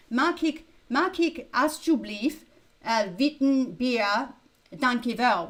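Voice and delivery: Mag ik alsjeblieft, witten bier, dankjewel said with Dutch sounds; Dutch phrase. Shown as a demonstration. Streamer showing how to order a beer in Dutch, Dutch pronunciation. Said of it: "in Dutch you go".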